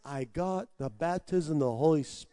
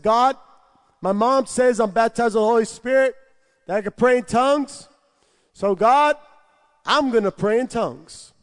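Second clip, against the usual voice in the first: raised, urgent call to God; loud declaration; 6 semitones higher, 8 dB louder than usual. Retold or imitated. Telling God loudly he will pray in tongues. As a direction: announce it to God at full voice. Shouting his vow to God.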